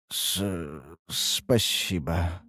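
slow, low, stammered thanks; uncertain mumble. A narrator's reading. Young man thanking for his cleansing, unsure mumble. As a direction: mumble it softly, tripping on the first sound. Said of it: "пробормотал парень неуверенно".

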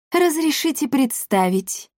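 high-pitched, mocking introduction; mock-courteous villainess. A narrator's reading. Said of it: "насмешливо произнесла миледи Лионелла".

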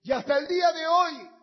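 altar call. Acted. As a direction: call them forward tenderly. Llamado al altar con música. (llamado al altar, íntimo y suplicante)

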